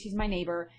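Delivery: ordinary delivery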